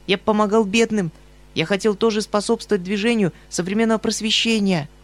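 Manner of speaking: high, pleading voice, near tears with shame; ashamed pleading young man. Acted. Obnoskin pleading with Yegor Ilyich, high near-tearful voice. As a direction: beg forgiveness, almost crying. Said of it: "полушёпотом, чуть не плача от стыда".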